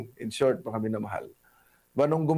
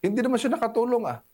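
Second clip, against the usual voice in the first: mock arrogant vlogger; 7 semitones higher than usual. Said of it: "ang sabi niya nung siya sumagana".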